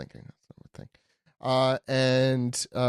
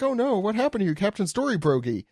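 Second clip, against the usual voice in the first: higher-pitched mock concern; a lightly hammed-up worried cry of "oh no"; concerned onlookers; 8 semitones higher than usual. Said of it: "as everyone is concerned".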